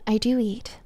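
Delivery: ordinary delivery, nothing marked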